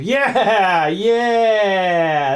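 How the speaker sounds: loud, high, drawn-out victory yell; triumphant cheer. Real, not acted. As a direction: cheer as the stubborn part finally gives in. Stubborn part finally goes in.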